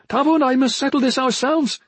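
high, forceful calling; Tasha's shouted insistence. A narrator's reading. Sister telling the onlooker to stay out, shouting back. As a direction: insist on it, voice pitched right up. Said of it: "Tasha shouted back".

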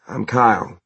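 lightly voiced surfer dude; drawling surfer. A narrator's reading. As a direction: introduce yourself like a surfer. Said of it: "more surfer than southern".

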